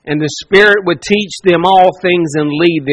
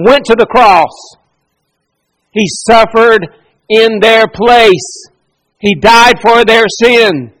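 higher, louder, slow and heavy preaching; preaching at full force; 3 semitones higher, 4 dB louder than usual. Acted. Preacher proclaiming Christ's death to the congregation, full force. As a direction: build the gospel climax with weight.

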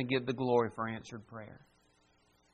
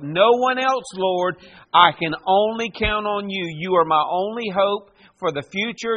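impassioned prayer; 5 dB louder than usual. Acted. Preacher voicing David's prayer.

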